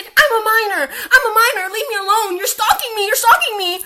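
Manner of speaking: high, loud mocking whine; mocking whiny voice. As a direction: mimic them as shrill and whiny. Mocking the person who blocked them.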